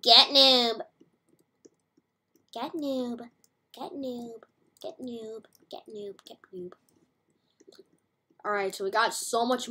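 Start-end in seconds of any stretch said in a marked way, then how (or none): 0.0-0.8 loud, punched mimicry; catchphrase imitation. Echoing the game's catchphrase aloud. 2.5-6.7 mimicked catchphrase getting softer and softer; fading echo. Repeating the catchphrase, fading away.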